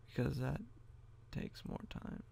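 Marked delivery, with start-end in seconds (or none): 1.3-2.2 whispered aside to himself; breathy whisper while working. Real, not acted. Muttering while fiddling with edit.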